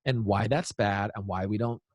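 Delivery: calm, composed parent. Acted. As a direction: talk it through quietly with your kid. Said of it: "take a breath and be like".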